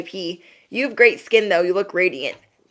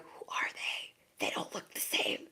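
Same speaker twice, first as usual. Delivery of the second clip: whispering; 18 dB quieter than usual. Retold or imitated. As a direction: whisper it like gossip. Whispered aside about changed faces.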